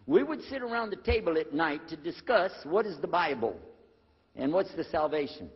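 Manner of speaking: ordinary talk, nothing marked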